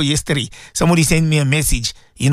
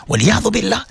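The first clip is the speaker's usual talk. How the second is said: normal talking voice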